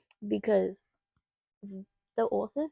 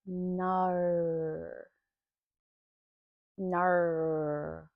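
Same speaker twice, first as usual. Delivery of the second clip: a badly faked Australian "No", drawn out; bad Aussie "no"; 2 semitones lower than usual. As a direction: mimic the narrator's wrong Aussie "No". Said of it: "We don't say no like that".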